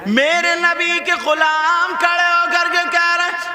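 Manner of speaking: high, loud, slow sermon chant; full-force preaching. Acted. Preacher voicing the greeting at the Prophet's door. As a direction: preach it out to the crowd.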